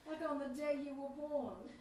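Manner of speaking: ordinary delivery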